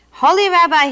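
wailing. A narrator's reading. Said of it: "he wailed".

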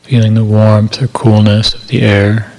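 calm, measured meditation voice with long silences; guided meditation. Acted. Meditation teacher settling listeners into body awareness, calm guided meditation. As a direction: guide listeners gently into body awareness, unhurried, evenly paced.

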